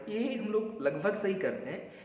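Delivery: normal talking voice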